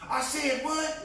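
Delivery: very high, loud comic challenge; mock confrontational challenge. Armed Texan challenging onlookers, high and loud mock threat.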